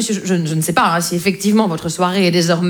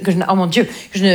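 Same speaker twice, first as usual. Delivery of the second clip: usual speaking voice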